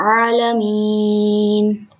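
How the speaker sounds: आलमीन with ain pushed from the throat, long ी; slow आलमीन, throaty ain. Shown as a demonstration. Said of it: "ज़रा ज़ोर लगा के हलक से".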